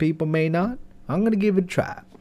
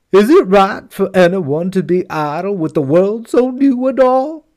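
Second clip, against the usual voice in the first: indignant horse; 4 semitones higher, 5 dB louder than usual. Acted. Horse complains to jinn.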